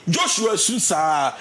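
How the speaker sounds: usual speaking voice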